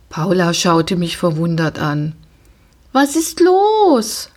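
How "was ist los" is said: high, slow wondering question; puzzled question. A narrator's reading. Girl wondering why her pony is acting up. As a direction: ask in bewildered surprise.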